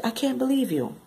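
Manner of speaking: high-pitched scolding disbelief; appalled disbelief. Retold or imitated. Reader scolding the cheating woman, appalled disbelief.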